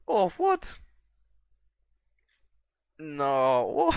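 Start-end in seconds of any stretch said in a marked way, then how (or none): 0.0-0.8 sudden high yelp of shock; startled yelp. Real, not acted. Watching his army fail, a startled high yelp. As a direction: jolt up high in surprise. Battle goes wrong, startled.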